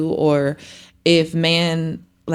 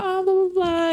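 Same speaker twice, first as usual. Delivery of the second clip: higher, sing-song dismissive babble; mock doting mom; 12 semitones higher than usual. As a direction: toss it off in a high doting voice. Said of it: "And I'm like".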